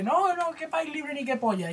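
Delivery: high, scornful villager; lightly hammed townsman; mock scornful townsman. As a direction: sneer him down as a mob member. Mob voiced in retold comic.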